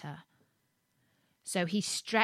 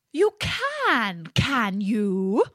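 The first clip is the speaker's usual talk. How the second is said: yelping fox; 2 semitones higher, 3 dB louder, much slower than usual. A narrator's reading. Fox pounces on the boasting gingerbread man, yelping. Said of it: "yelped the fox".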